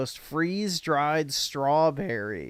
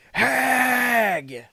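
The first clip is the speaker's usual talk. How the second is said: loud, higher shout of Hag!; shouted insult; 6 semitones higher, 4 dB louder than usual. Real, not acted. Calling the streamer a hag.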